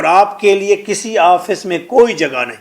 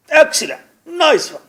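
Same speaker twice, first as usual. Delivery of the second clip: impressed praise; 8 semitones higher than usual. Retold or imitated. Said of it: "یہ کہے".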